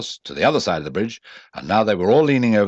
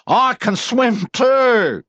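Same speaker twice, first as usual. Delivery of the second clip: very high, loud, slow boast; proudly; 6 dB louder, much slower than usual. A narrator's reading. Roo telling Eeyore, proud. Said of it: "said Roo proudly".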